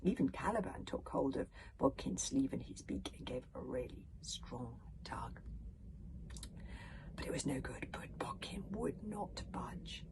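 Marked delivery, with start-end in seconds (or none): 3.6-10.0 tense whisper. A narrator's reading. Tense failure narrated.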